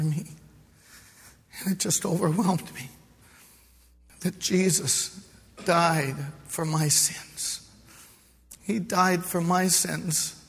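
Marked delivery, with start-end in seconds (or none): 1.6-10.4 choked up. Real, not acted. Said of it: "I wept some more".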